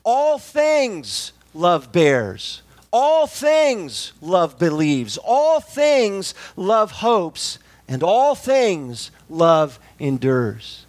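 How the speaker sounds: very slow, each line led by ALL THINGS; slow literal reading. Shown as a demonstration. Preacher reading the verse in Greek order, very slow and stressed. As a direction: read the Greek word order slowly, hitting all things. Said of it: "it would be".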